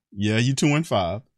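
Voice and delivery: ordinary talk, nothing marked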